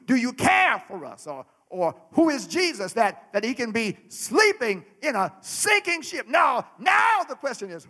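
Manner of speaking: normal talking voice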